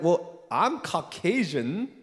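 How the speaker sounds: slow, drawn-out, put-on voice; mock confident claim. Retelling an Indian American applicant's slow smug claim. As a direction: claim it slowly and smugly. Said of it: "Indian American person was like".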